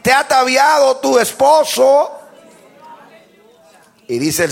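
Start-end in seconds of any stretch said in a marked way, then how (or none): 0.0-2.1 high, loud pulpit proclamation compared with plain talk; predicación enérgica. Real, not acted. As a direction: proclaim each phrase with full force. Preaches at full force. (prédica a toda voz)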